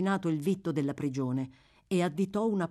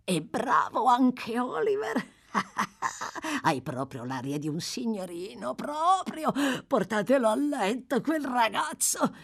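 put-on high-pitched cackling old rogue; delirious rambling; 6 semitones higher than usual. A narrator's reading. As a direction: ramble to yourself with a mad laugh. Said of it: "continuò a borbottare".

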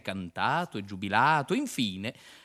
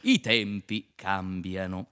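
slow portentous line; 4 semitones lower, slower than usual. Acted. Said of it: "disse il primo".

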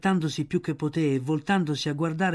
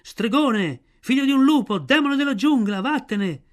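high, loud accusing cries; crowd shouting; 9 semitones higher, 5 dB louder than usual. A narrator's reading. Villagers yell at Mowgli to drive him away, shouting. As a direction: shout it out like an angry mob. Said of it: "la gente prese a gridare".

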